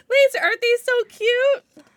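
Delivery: loud, high squeal of delight; excited gushing. Real, not acted. Streamer showing Lisa the cute plushies, excited squeal.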